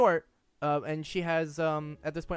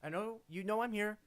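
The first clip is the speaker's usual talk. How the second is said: higher-pitched wary caster voice, easing down; high, tentative plea to come in; caster calling out; 7 semitones higher than usual. Acted. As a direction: placate them from round the corner, pitched up at first. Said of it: "the voice of the, the caster".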